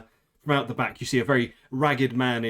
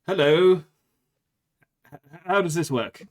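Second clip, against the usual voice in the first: a touch higher, hesitant and puzzled; tentative soldier; 4 semitones higher, 4 dB louder than usual. Acted. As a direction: try it out, unsure it works. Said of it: "picks up a parasol and goes".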